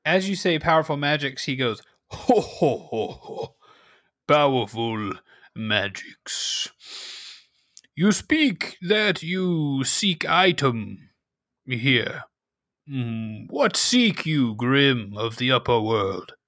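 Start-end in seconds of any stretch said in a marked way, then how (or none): 2.1-3.6 hammed-up ho ho chuckle; mock chuckle. Villager chuckles at magic. 4.3-16.3 slow, measured cave elder. Acted. GM voices Tunach.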